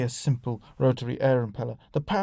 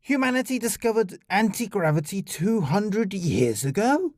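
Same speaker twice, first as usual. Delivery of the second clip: high-pitched disbelief, leaping up at the end; incredulous; 6 semitones higher than usual. Acted. Alien scientist rounds on diplomat in astonishment, incredulous. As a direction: demand it as an astonished question. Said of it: "clearly surprised by his tone".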